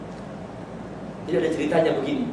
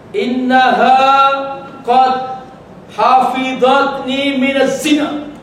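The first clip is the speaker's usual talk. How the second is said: slow, loud, high chanted Arabic; preacher recites Umar's words to the congregation; Arabic recitation; 8 semitones higher, 4 dB louder, slower than usual. Acted. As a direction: intone each word slowly and with weight. Quoting Arabic in sermon.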